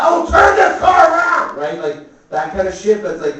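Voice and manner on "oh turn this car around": high, loud mock shout; imitated angry parent. Parent yelling at kids in the back seat, angry mock shout. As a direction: yell it at kids behind you.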